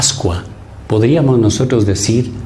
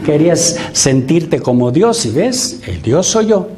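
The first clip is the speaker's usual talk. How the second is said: mocking scold; 5 semitones higher than usual. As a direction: jeer at the sinner like a smug judge. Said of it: "No le dice".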